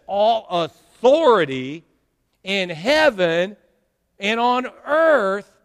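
slow, loud, drawn-out scripture quote; preacher declaiming scripture; slow preached verse. Acted. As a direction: lay out each phrase slowly.

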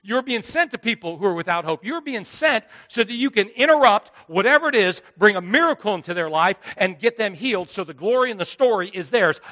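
usual speaking voice

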